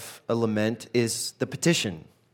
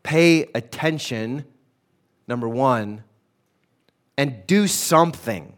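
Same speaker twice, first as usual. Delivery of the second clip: a louder cry of God, then slow pay attention; urgent plea; 4 dB louder, slower than usual. Pretend. Pastor voicing the believer's cry, louder and slow. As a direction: call out to be heard.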